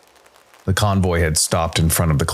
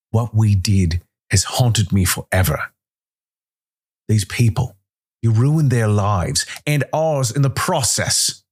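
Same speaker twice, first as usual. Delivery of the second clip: higher and rising with anger; haunted anger; 5 semitones higher than usual. A narrator's reading. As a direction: accuse him, voice climbing. Calder confronts Winters with pistol.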